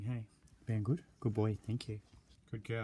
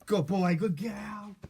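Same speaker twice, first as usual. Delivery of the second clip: loud praise; 4 semitones higher, 13 dB louder, slower than usual. Real, not acted.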